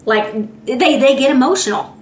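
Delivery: normal talking voice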